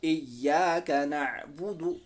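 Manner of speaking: slow chanted "इय्याका नअबुदु"; slow Quranic chant; slow recitation. Shown as a demonstration. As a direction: recite it slowly like tilawat. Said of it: "दोबारा से repeat करेंगे".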